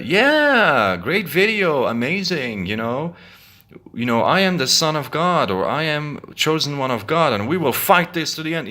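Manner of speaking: high, mocking fake praise; sarcastic. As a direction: gush it with sarcasm. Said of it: "suggests to me that they're being sarcastic".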